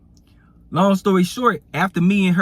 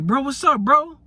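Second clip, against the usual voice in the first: much higher, excited hello; hyped greeting; 11 semitones higher than usual. Retold or imitated. Partner calling him on New Year's Day, hyped greeting.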